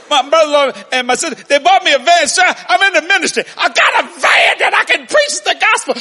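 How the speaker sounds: high, loud, fast gush of excitement; excited plea. Retold or imitated. Preacher overjoyed, explaining his new van to trooper, excited shouting. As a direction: pour it out to the officer, thrilled.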